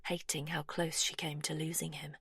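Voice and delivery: ordinary delivery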